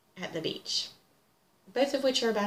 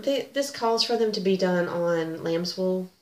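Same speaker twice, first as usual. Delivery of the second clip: ordinary delivery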